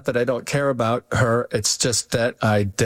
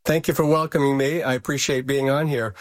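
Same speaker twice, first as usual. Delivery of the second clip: normal talking voice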